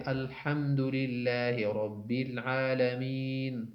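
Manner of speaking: الحمدللہ رب العالمین recited slowly in Arabic; slow recitation. Shown as a demonstration. Teacher demonstrating slow Quran recitation to students. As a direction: draw out الحمدللہ like Quran reading. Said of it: "قرات میں کہتے".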